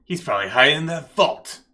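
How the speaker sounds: forceful detective on the hunt; accusing detective. Acted. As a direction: accuse them, driving up the volume. Detective doubts the player.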